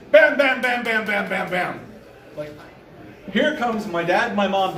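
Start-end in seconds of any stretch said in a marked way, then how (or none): 0.1-1.8 rapid bams, starting loud then dropping; imitated door banging. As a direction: hammer the knocks out quickly.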